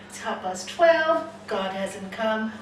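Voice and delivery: ordinary talk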